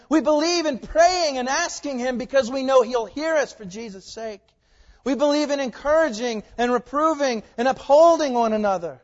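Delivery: loud, high-pitched creed-like proclamation; full-force preaching. Real, not acted. Pastor declaring what the church believes, full-force preaching. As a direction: proclaim each belief to the whole room.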